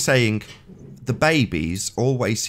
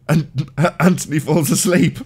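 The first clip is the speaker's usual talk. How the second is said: high, loud, slow, breaking into laughter; laughing; 9 semitones higher, 5 dB louder than usual. Real, not acted. Co-host teasing friend who fell asleep, laughing. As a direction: crack up while getting the words out.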